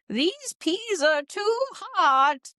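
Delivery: very high, slow crotchety granny; a cranky old woman complaining; old lady voice. Shown as a demonstration. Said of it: "What should I say in an old lady voice".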